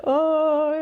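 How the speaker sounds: high, loud pained cry; small girl with a broken tooth, crying out. Retold or imitated. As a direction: wail like a hurt child. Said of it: "I was like".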